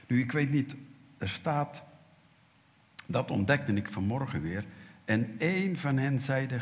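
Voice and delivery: ordinary talk, nothing marked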